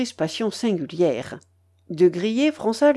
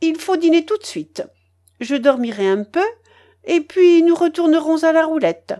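higher, lively imperious matriarch; bossy old grandmother; 8 semitones higher, 5 dB louder than usual. Acted. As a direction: announce the plans, brooking no objection. (babouchka décidée, voix plus haute)

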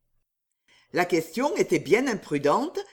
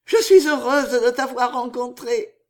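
louder, higher happy declaration; joyful Riquette; 4 semitones higher, 7 dB louder than usual. Acted. Riquette to her lover once alone, happily gushing. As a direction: gush it to a sweetheart.

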